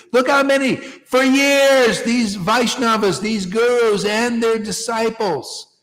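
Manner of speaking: high, loud, impassioned sermon; fiery preaching. Acted. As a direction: preach it with fire to the assembled. Preaching at full force.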